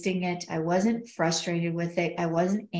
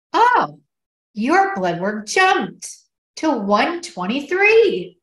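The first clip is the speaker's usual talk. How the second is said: much higher and louder, drawn-out happy news; retelling the doctor's thrilled announcement; excited doctor; 6 semitones higher, 8 dB louder, slower than usual. Retold or imitated. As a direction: burst out with delighted surprise. Doctor announces surprising good news.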